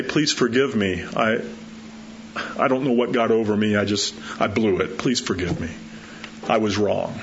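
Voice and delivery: soft, low humble confession; soft heartfelt apology; sincere plea. Shown as a demonstration. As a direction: quietly own the wrong.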